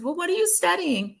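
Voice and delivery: high, put-on questioning voice; imitated curious woman. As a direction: ask it high, imitating her interest.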